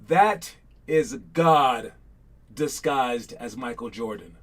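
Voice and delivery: drawn-out quote, pausing between phrases; slow suspenseful quote reading; slow dramatic read. Shown as a demonstration. Reading out trivia quote slowly.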